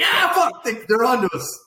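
high, loud comic yell; hammed-up alarmed shout; mock panic. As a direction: act caught out and scrambling to flee. Mimicking politician fleeing in panic.

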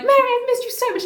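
high-pitched, effusive greeting; gushing reunion. Acted. Lizzie grabs her and greets her, gushing. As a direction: gush it high with affection.

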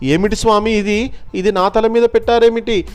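desperate high-pitched wail of pain; crying, begging. A narrator's reading. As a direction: beg in pain, voice climbing. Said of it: "గట్టిగా ఏడ్చాడు, బతిమిలాడాడు".

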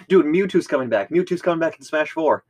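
high, loud excited rush; excited kid. Retold or imitated. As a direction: tell a friend big news, thrilled. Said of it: "I went up to you and was just like".

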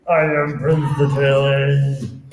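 loud slow royal declaration; proclamation. Acted. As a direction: proclaim your name. Prince announces himself.

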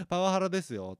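a lightly acted outraged objection; mock wide-eyed accusation. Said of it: "目ひんむかれて言われたら". (目をむいて訴える真似)

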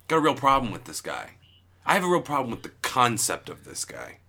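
normal talking voice

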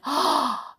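sharp, high gasp; acted gasp of horror; horrified gasp. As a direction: suck in breath in shock. Horrified by crocodile's hint.

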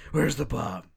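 mock-urgent interrogation; Batman voice. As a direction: bark it like Batman grilling a villain. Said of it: "doing the Batman voice".

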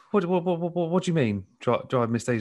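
retold confused protest; mock flustered. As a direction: stammer it out, mildly baffled. Acts own flustered reply in story.

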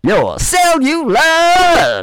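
high, shouted outro host voice; show sign-off. Acted. Signing off his episode, hammed-up announcer shout.

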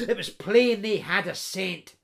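usual speaking voice

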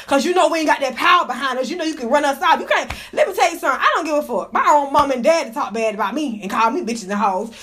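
ordinary talk

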